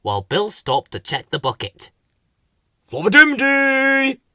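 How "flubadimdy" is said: high, loud, drawn-out call; raised silly flowerpot-man holler. A narrator's reading. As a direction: answer with a long last syllable. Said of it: "called Bill".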